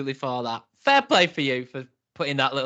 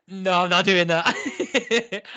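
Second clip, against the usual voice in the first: laughing refusal; 5 semitones higher, much slower than usual. Retold or imitated. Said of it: "I even did a big inhale".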